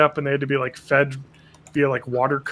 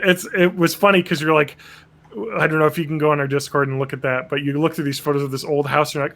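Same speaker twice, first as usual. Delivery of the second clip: ordinary delivery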